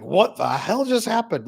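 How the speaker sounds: high, slow, stunned; drawn-out disbelief; mock bafflement. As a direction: play the blindsided bystander.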